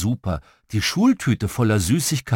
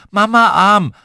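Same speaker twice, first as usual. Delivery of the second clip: put-on high, loud toddler demand; 9 semitones higher, 10 dB louder than usual. A narrator's reading. Little sister trailing after mum wants carrying, demanding. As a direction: insist loudly like a small child. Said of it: "lief sie hinter Mama her und forderte".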